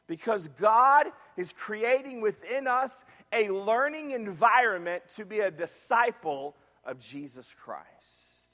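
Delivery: usual speaking voice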